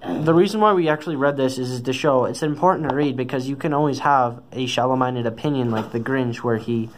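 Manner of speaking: plain reading voice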